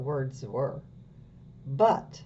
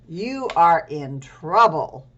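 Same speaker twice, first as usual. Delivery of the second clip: stern police officer; 9 dB louder, slower than usual. Acted. Police officer voiced in story.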